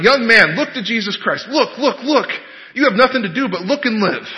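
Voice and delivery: high, urgent mock pulpit shout; imitated shouted plea, pitched high; preacher shouting. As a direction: cry out like an old-time Methodist exhorter. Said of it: "started shouting at the top of his lungs".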